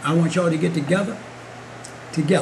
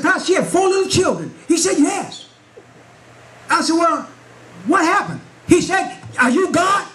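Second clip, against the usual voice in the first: preaching at full force; 8 semitones higher than usual. Acted. Preacher retells the pastor's rebuke to his church, preaching. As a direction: lift it higher and higher to the congregation.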